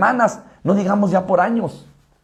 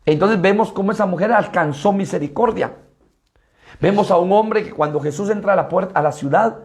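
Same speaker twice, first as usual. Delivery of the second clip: normal talking voice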